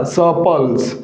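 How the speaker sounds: सपल्स with the r silent; correct pronunciation. Shown as a demonstration. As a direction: say सपल्स, not सरपल्स. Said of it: "न कि सरपल्स". (सपल्स, आर की ध्वनि के बिना)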